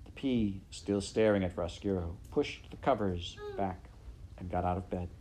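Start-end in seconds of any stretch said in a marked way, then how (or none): none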